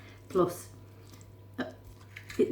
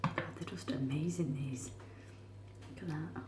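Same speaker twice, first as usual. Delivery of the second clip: whispering; 11 dB quieter than usual. Real, not acted. Whispering while eating.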